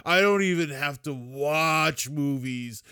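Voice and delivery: slow, spaced-out stoner voice; sleepy, drifting stoner talk; stoned. Pretend. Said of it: "Man, I'm so stoned".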